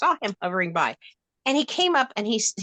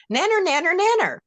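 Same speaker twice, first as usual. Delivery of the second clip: sing-song teasing nanner nanner, pitched up high; mock taunt; 10 semitones higher, 3 dB louder than usual. Teasing rude strangers in a high sing-song taunt. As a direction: taunt them like a schoolkid.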